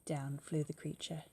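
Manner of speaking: usual speaking voice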